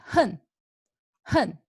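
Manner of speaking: emphatic falling "hen"; fourth tone. Shown as a demonstration. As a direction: punch out "hen" with strong feeling. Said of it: "It's so strong emotion".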